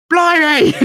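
high-pitched mock squeal; over-excited Ron. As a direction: pipe up suddenly, absurdly excited. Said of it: "Ron just goes".

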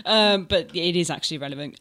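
ordinary delivery

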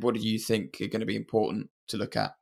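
ordinary delivery

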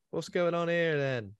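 lightly voiced British copper; London policeman. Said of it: "someone starts coming down the stairs".

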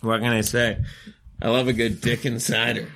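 ordinary talk

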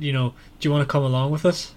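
ordinary talk, nothing marked

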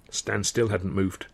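ordinary delivery

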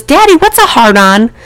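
high, loud child imitation; little girl. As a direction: pipe up like a curious daughter. Voicing the film's young daughter.

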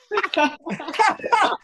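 high burst of laughter. Real, not acted. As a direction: crack up laughing. Laughs after reading the text.

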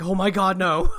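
loud mock cry through a laugh; retold scream. Fan imitating Rincewind screaming, loud mock cry. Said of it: "I just love Rincewind screaming".

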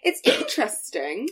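laughing mock-polite voice, rising high; fake-nice and laughing through it; backhanded compliment. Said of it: "the equivalent of being like".

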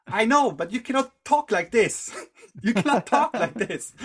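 high, loud acted reprimand; emphatic scolding. Monk interrogating him, acted quarrel.

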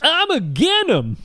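put-on high, slow old prospector drawl; crazy old prospector. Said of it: "that's crazy old prospector".

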